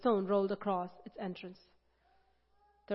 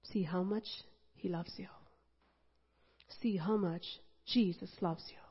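soft, hushed assurance; tender reassurance; 7 dB quieter than usual. Real, not acted. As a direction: lean in and comfort them.

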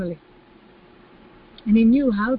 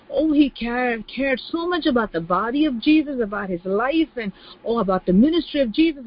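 ordinary talk

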